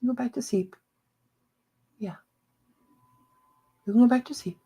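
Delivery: high, hushed pet voice; soothing puppy talk. Real, not acted. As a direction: gently coax a puppy back to sleep. Soothing puppy back to sleep.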